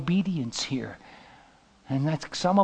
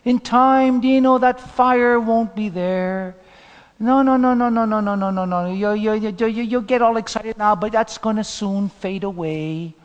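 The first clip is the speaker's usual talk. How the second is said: mock know-it-all church elder, raised pitch; dampening board member; 5 semitones higher, 5 dB louder than usual. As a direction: talk down a young believer, stretching the words. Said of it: "I came across a board member".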